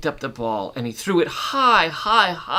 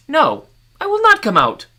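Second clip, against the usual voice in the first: much higher, loud woman's voice; refusing woman; 9 dB louder than usual. Acted. Woman answering the man from inside, high stubborn refusal.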